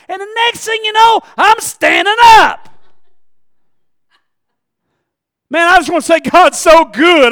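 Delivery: high, loud preaching cry; loud church testimony; excited testifying shout. Retold or imitated. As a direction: ring it out like jumping up to testify. Retold church call and standing up.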